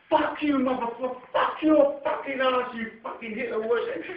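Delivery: high, loud mock screaming; imitating screamed insults. Old man screaming insults at him, a mock screaming rant. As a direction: spit out the insults in a hammed-up scream.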